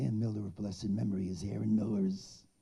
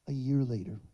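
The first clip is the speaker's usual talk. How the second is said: usual speaking voice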